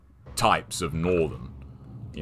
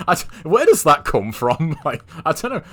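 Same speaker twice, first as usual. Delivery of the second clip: usual speaking voice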